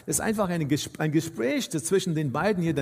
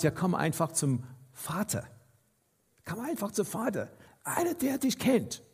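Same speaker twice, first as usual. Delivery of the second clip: soft, much quieter, inviting; gentle invitation; 6 dB quieter than usual. Acted. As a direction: softly coax the listener to come. Jesus's gentle invitation quoted. (sanft und leise einladend)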